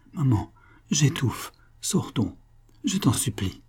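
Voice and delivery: half-whispered, breathless plea; faint, suffocating. Acted. Concepcion begging her mother to leave, suffocating. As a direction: beg softly as if short of air.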